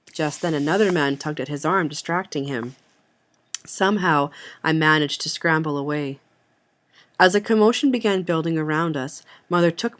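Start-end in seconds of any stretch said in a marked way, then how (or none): none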